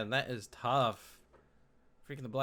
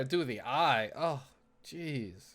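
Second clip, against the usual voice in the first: ordinary delivery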